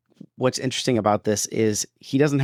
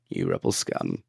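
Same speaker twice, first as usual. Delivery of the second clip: whispered quote; 13 semitones lower, 6 dB quieter than usual. Retold or imitated. Quoting the line.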